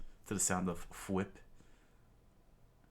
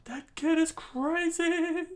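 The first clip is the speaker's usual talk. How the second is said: very high, loud, slow squeal; stunned pirate; 8 dB louder, slower than usual. A narrator's reading. Pirate stunned by Luffy, high loud squeal. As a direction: squeal it in cartoon shock.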